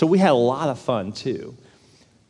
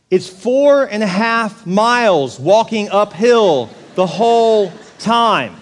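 "four and a half miles" stretched out slowly, higher; drawn-out emphasis; 5 semitones higher, 4 dB louder, much slower than usual. Real, not acted. Preacher telling the congregation about a gruelling uphill hike, drawn-out emphasis. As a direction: drag out every word through "the whole time".

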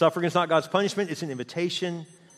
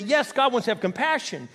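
normal talking voice

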